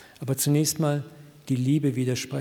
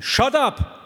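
booming shout, much higher and louder; thundering voice; 11 semitones higher, 6 dB louder than usual. Retold or imitated. God thundering inside the preacher's head, thunderous shout. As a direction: bellow it like thunder. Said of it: "in meinem Kopf eine Donnerstimme".

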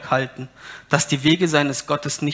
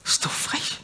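whisper; 6 dB quieter than usual. Real, not acted. Aside on cheeky people.

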